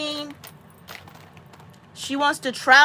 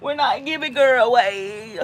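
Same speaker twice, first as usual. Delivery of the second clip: mock protest; slower than usual. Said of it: "I'm like".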